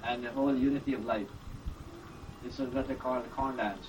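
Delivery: usual speaking voice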